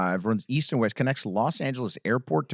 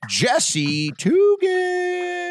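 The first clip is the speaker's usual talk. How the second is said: long, drawn-out, higher stage announcer call; announcer intro; 4 dB louder, much slower than usual. Acted. Host announcing the first comic to the room, drawn-out announcer call. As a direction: stretch the name like a boxing announcer.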